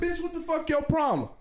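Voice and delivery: angry yell. Retold or imitated. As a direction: yell it at her in outrage. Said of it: "I'm not quiet no more".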